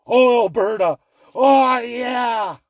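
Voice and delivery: drawn-out sarcastic cheer; mock gushing. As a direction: gush with fake delight. Mock gushing over Alberta oil.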